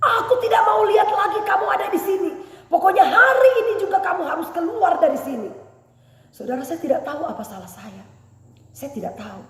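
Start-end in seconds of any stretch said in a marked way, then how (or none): 0.0-5.5 higher, louder mock shout; retold angry shout; angry landlady. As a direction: throw her out like the angry landlady. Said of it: "Dia katakan begini".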